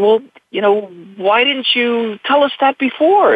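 the tired father asks why nobody said so before; retold exasperation. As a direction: play your past self, baffled and put out. Retold exasperated question to director.

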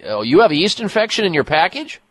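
normal talking voice

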